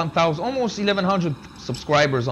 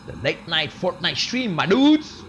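hyped title announcement. Acted. Hyped repeat of the stream name.

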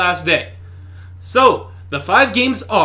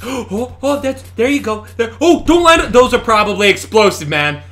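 ordinary talk

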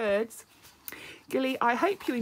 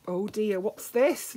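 plain reading voice

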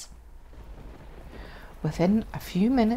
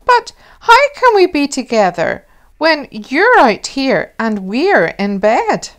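put-on high, loud child asking; an eager little girl, higher and louder; child pleading from bed; child's voice; 6 semitones higher, 9 dB louder than usual. A narrator's reading. Child questioning mum.

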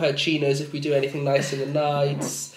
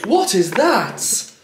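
1 startled; 10 semitones higher, much slower than usual. Retold or imitated. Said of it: "I heard a rustle and I was like".

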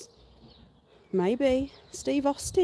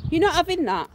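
raised, loud refusal; firm scolding; 8 semitones higher, 6 dB louder than usual. Real, not acted. Owner to pet grabbing a find, firm and loud. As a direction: put your foot down with a naughty pet.

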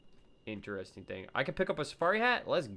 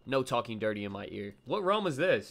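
usual speaking voice

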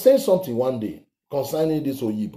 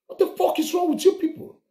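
high, fast, fed-up yell; exasperated outburst; faster than usual. Real, not acted. The ranter snaps at his opponents, fast, exasperated and high. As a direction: explode at them in disbelief.

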